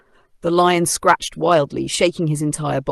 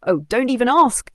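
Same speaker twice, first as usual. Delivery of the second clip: sighed; 8 semitones higher, 4 dB louder than usual. A narrator's reading. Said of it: "sighed the mouse".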